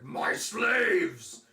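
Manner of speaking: high, very loud enraged dragon roar; furious roar. Acted. Dragon watching its slaves escape, furious roar. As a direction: bellow at the loss of your slaves.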